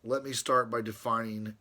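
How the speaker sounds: reading his letter to Brother Ron aloud, formally. Acted. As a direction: read it like a solemn treatise. Reads from his page.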